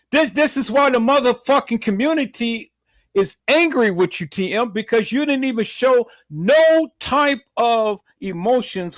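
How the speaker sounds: angry rant. Real, not acted. Ranting at a grieving mother.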